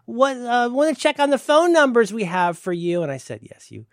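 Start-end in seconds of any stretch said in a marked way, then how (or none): 0.0-3.0 much higher, louder, chirpy put-on clerk voice; high mock receptionist. Mocking the receptionist who runs through his phone numbers, high and chirpy. 3.4-3.9 patient and much quieter; flatly tired, nearly under his breath; much softer, tired reply. Retold or imitated. Answering the receptionist's questions, much softer and worn out.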